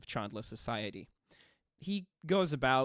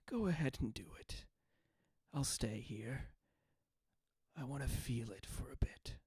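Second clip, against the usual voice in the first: calm, satisfied ghost whisper; hushed and content, savoring it; 8 dB quieter than usual. Acted. Ghost calmly accepts poison.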